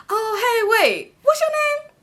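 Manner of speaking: high-pitched imitation of the son; high, mimicked double take; surprised recognition. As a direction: break off the high hello to ask. Voicing the son meeting her.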